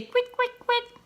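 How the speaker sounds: high chirpy partridge cry; partridge call. Acted. Imitating the partridge.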